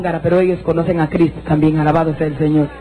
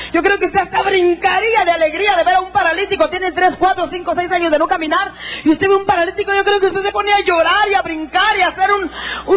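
high, sustained exhortation; fervent preaching; 11 semitones higher than usual. Real, not acted. Preacher to congregation on seeing paralytics walk, fervent high exhortation. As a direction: preach it with the pitch held way up.

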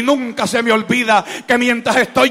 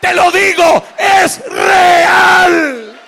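high, loud shouted declarations with stretched endings; full-force preaching; 7 semitones higher, 8 dB louder, slower than usual. Real, not acted. Preacher testifying to congregation that God is real, full-force preaching. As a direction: thunder it out to the whole congregation.

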